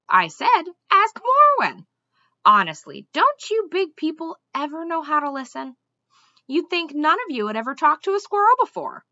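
the squirrel snapping at a bad listener; exasperated repeat.

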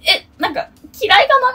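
intimidated, re-enacted. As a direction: act out a jumpy worry that they dislike you. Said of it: "なんかビビるんですよね". (ビビった心の声を再現)

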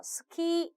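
"스키" with the last vowel drawn out; long final vowel. Shown as a demonstration. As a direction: hold the "키" in "스키" long. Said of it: "장음 처리가 들어가서". ("스키"의 "키"를 길게 늘여서 발음)

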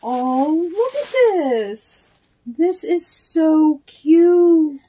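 high, slow, loud cooing over a cute gift; delighted gush. Real, not acted. Unboxing happy mail, she coos over the gift. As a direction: melt over how cute it is.

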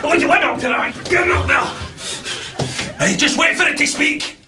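furious rant, shouted; angry shouting. Real, not acted. Man ranting at the lift, shouting angrily.

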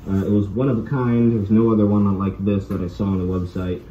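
muffled behind mask; speaking inside respirator; through a gas mask. Real, not acted. Seen 2: Gas mask worn over face throughout labelled words; absent in references.

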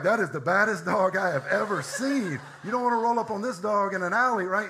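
high and loud, laughing through the dog's thoughts. Retold or imitated. Pastor voicing his dog admiring its own reflection, laughing. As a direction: voice the dog's bravado while cracking up. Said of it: "He's like".

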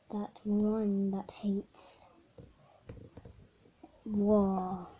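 slow whisper; imitating the villain's slow whisper; mock whisper. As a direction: drag it out in a whisper.

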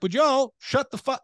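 high, mock Southern yell; a hammed-up Southern local snapping back. Mocking Southerners telling outsiders to stop asking, high mock yell. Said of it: "So they're just like".

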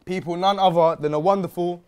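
loud announcer build to a high shouted name; guest introduction. Real, not acted. Host presents the special guest to viewers, rousing announcement.

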